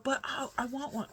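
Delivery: put-on high whiny kid plea; imitating whiny son. Son whining to mom for a neck fan, high and whiny. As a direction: pout it like a little boy.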